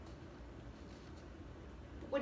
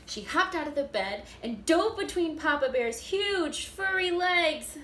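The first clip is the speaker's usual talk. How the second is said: lively, louder read-aloud with bear voices; animated storybook reading; 4 dB louder than usual. A narrator's reading. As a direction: act it out for the first graders. Reading picture book aloud.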